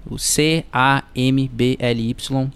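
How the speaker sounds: letter by letter, slowly; spelling it out. Shown as a demonstration. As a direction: spell each letter clearly. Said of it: "soletrando pra vocês".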